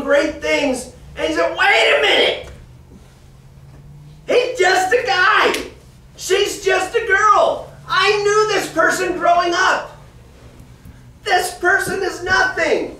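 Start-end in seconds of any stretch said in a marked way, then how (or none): 1.6-13.0 scoffing outburst. Acted. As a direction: throw up your hands: wait, he's nobody. Onlookers scoff at anointed minister.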